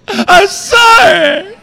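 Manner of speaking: loud, high, stretched-out wailed apology; hammed-up sobbing man; mock crying. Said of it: "tears are just gushing".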